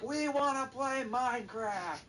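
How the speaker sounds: put-on very high, drawn-out kid whine; hammed-up slow, high childish demand; whining kids. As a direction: imitate a mob of children wailing. Horde of kids whining back.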